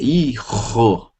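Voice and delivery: hijo with a throaty h, slow; Spanish hijo. Shown as a demonstration. Teacher models the Spanish hijo for students. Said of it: "Como se eu falasse".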